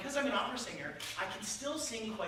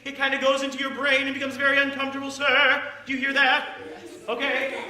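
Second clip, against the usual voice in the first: very loud, high operatic projection; sustained full-voice speech, relentlessly loud; unbroken wall of sound; 5 semitones higher, 11 dB louder than usual. Shown as a demonstration. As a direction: blast it out like an opera singer. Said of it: "There are no breaks in it".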